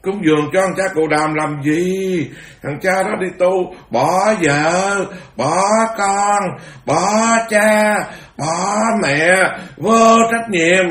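high, loud mocking rant, last words slow; voicing the hired mob cursing the Buddha at the door; heckler's abuse. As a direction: shout scorn at the wandering monk. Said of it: "nó đứng trước nhà nó chửi".

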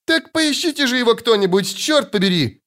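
high-pitched exasperated call; high, agitated outcry; exasperated cry. A narrator's reading. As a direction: demand it in a high voice. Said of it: "крикнул Лютик".

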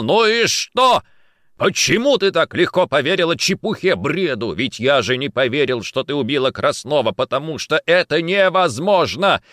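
loud, high, impassioned argument; exasperated. Acted. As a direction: argue back hotly, incredulous. Heated lovers' argument.

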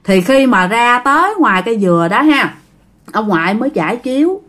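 ordinary delivery

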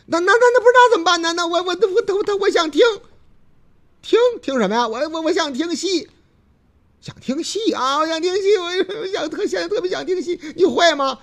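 put-on high, fast stammering girl; 慌乱结巴的姑娘. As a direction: stutter out the wish in a panic. 姑娘慌乱结巴. (姑娘尖声结巴着要听戏)

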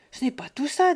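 sharp objection. Acted. Buteau objects.

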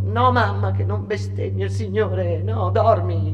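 urgent reply. Acted. Daughter scolds and soothes her mother, loud and pleading. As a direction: hush her mother firmly.

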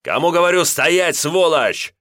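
громко и высоко, яростно; орать, догоняя вора; яростный крик. A narrator's reading. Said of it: "заорал Леонтий". (Леонтий кричит вслед убегающему карманнику)

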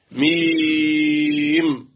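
ميم held long for six counts on the long vowel; drawn-out madd. Shown as a demonstration. Teacher drawing out ميم for his students. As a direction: stretch ميم slowly like Quran recitation. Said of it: "ده مد لازم حرف إيه".

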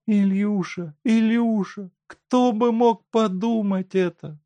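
high, tearful-sounding wife voice; grieving wife. A narrator's reading. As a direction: speak through sorrow to him.

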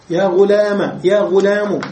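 يا غلام first with final a then with final u; teacher demonstrates غلام ending in a, then u; three vowel endings. Shown as a demonstration. نطق غلام بالفتح ثم بالضم. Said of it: "بالثلاث".

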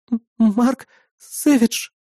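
high, slow, stammering answer; frightened. A narrator's reading. Girl answering with knife at throat, frightened. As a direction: stammer the name out in fear. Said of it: "испуганно ответила она".

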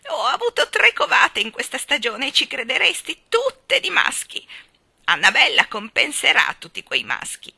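loud, high, gushing outburst; excited crowing. A narrator's reading. Starling boasts about his broods, excited crowing. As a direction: crow loudly, pitch leaping up. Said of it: "egli gridò".

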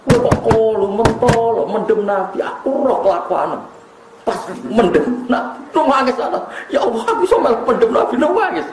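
high-pitched mock scolding, laughter creeping in; Fatimah. Grieving daughter scolds Anas over burying the Prophet, mock-indignant. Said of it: "Bareng Anas mulai dimaki-maki".

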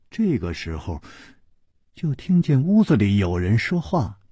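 ordinary delivery, nothing marked

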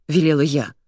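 ordinary delivery, nothing marked

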